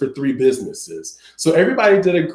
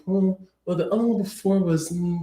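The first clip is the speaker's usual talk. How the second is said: soft, higher-pitched pondering murmur; thinking aloud; 4 semitones higher, 6 dB quieter, faster than usual. Retold or imitated. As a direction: imitate a client mulling it over under his breath. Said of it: "and he would think".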